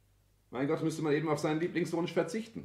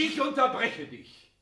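much higher, slow, commanding; firm interruption; 10 semitones higher, 5 dB louder, slower than usual. Acted. Son stopping his father's tirade, firm and slow. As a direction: cut him off, deliberately.

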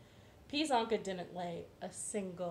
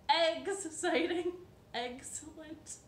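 playful wordplay; 7 semitones higher than usual. Real, not acted. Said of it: "See what I did there?".

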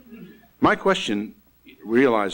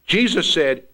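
ordinary talk

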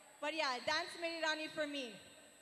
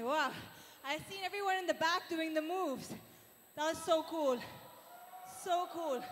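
ordinary talk

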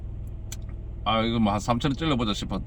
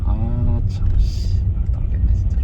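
cursing under breath; 2 semitones lower, slower than usual. Real, not acted. Frustrated muttering after losing.

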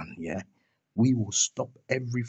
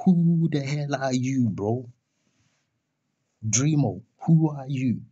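raised, scornful demand; heated and higher, putting a rival down; indignant challenge; 4 semitones higher, 4 dB louder than usual. Real, not acted. Demanding who Dreamo thinks he is, indignant.

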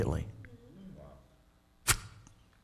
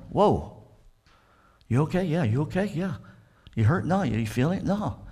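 higher, wondering voices checking on each other; amazed boys; 6 semitones higher than usual. Acted. Preacher voicing the boys finding themselves unhurt in the fire, amazed and higher. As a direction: sound stunned that nothing burns.

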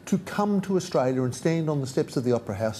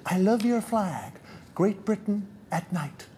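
lightly voiced comedian impression, high and slow; a hint of a stand-up's drawn-out delivery; Jerry Seinfeld; 6 semitones higher, slower than usual. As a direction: quote the punchline in a loosely imitated comic's pitch. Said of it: "that line from Jerry Seinfeld".

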